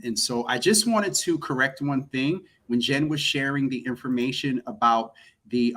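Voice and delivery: ordinary talk, nothing marked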